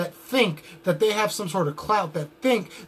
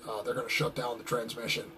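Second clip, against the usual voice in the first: Alex Jones impression; 9 semitones lower, 10 dB quieter than usual. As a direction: lower conspiracy-radio rant voice, stammering with uh. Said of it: "there's Alex Jones from InfoWars".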